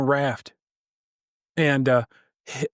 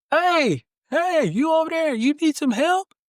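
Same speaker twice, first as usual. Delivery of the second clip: much higher voice hailing from a boat; rescuer calling out; 13 semitones higher, slower than usual. Acted. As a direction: call across the water to a stranger. Joke: second boat calls out.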